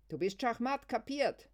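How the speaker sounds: high, loud triumphant yell; shouting. Acted. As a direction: crow it over her. Luise schreit weiter. (schreiend und triumphierend)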